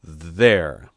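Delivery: a loud, satisfied pronouncement; firm and victorious; triumphant. A narrator's reading. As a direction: announce it with triumph. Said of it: "he said triumphantly".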